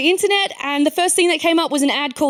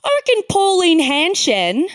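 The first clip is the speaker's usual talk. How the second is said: mock yokel bloke; 6 semitones higher than usual. Local bigot quoted at pub.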